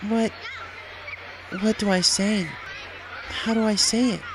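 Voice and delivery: nervous mother afraid to face her daughter; anxious hesitation. A narrator's reading. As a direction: fret aloud, lost for words. Firefly hesitant, unsure what to say.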